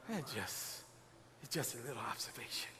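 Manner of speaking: hushed, fast aside; whispered aside. Real, not acted. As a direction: drop to a quick whisper. Soft aside.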